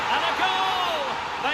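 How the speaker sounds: "And a goal!" slow, high and shouted; goal call. Acted. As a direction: stretch the goal out, then stay high and thrilled. Commentator calls opening goal.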